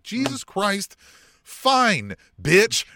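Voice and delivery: high, loud, fed-up brush-off; hammed-up exasperated wrestler; exasperated MJF. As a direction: snap it off like he's had enough.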